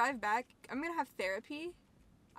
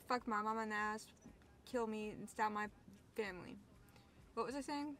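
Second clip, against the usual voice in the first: normal talking voice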